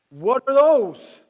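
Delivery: loud, high, drawn-out heckle; stretched, high-pitched holler; mock outraged shout. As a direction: shout it out in comic disbelief. Repeats the shocked shout at shoes.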